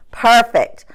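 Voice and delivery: ordinary talk, nothing marked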